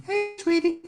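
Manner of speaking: high whistled chickadee; chickadee call. Shown as a demonstration. Teacher giving the chickadee mnemonic, high whistle.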